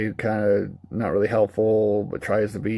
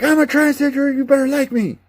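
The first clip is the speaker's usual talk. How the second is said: much higher, louder shrill parody; mock preachy character; 5 dB louder than usual. Mocking a preachy game character: much higher mocking squeal. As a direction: squeal it as an over-the-top caricature. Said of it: "come out and be like".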